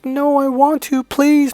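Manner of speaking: very high, put-on pleading voice; begging girlfriend. Girlfriend begging to cook dinner for boyfriend, high pleading voice. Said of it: "she was just begging me".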